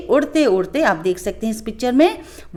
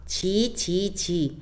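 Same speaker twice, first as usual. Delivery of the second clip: a quiet, disgusted tut from the fly; softly, disgusted; 3 semitones lower, 7 dB quieter, much slower than usual. Acted. Fly softly scolds the lion, quiet and disgusted. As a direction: murmur the disgust softly, shaking your head. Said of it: "मक्खी ने धीरे से कहा".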